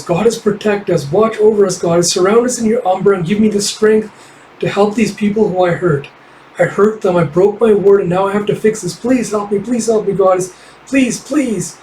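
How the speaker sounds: put-on pitched high, a young girl begging her goddess; high fox-girl prayer. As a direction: pray desperately in a small high voice. Kippa praying to her goddess.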